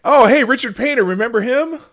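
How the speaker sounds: high, loud burst of recognition; sudden excited recall. Real, not acted. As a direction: blurt it out loudly as it comes back. Suddenly recalls a name, calls out.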